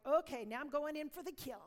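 loud, eager mock-fierce declaration; eager resolve. Planning to lead Sherry to Jesus, loud eager resolve. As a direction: charge ahead with zeal. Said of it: "I was like".

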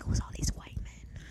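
whispered, timid aside; scared whisper. Retold or imitated. Scared newcomer whispering about the cowboys. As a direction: hush it like you're nervous.

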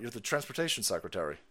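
normal talking voice

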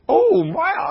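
high, loud exclamation, acting out amazement; amazed relief. Guy imitated after being saved from wasps, amazed. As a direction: burst out with an impressed wow, retold.

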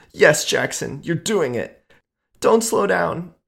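high, loud, urgent squeal. A narrator's reading. Lover urging Jackson on, squealing high and loud. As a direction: squeal it at him, begging. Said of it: "she squealed loudly".

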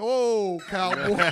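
loud, laughing "Oh, cowboy"; half-laughing mock scold. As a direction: laugh it out at the cop. Mocking the cop, laughing.

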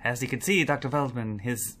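lightly voiced thirties mad scientist; Henry Frankenstein. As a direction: play a proud old-movie scientist. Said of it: "your Henry Frankenstein".

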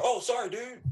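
high, startled sorry; sheepish apology. Retold or imitated. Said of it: "they're just like".

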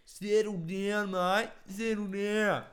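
very loud, high, slow Aussie bogan; high-pitched bogan. Acted. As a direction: squawk it out like the bogan bloke. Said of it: "Those real high-pitched bogans".

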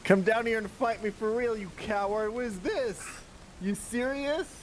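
high, indignant challenge called upward; in-character shouted dare; yelling up. Acted. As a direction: yell up at an enemy to come fight. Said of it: "I yell up at her".

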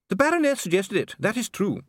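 a cornered dwarf pleading his case; anxious dwarf Dee. Acted. Dee defends himself before the king, high and pleading.

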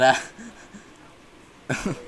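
slow, strained choked voice; strangled voice. Real, not acted. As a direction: squeeze the words out strangled. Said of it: "Why do you sound like a dying cat?".

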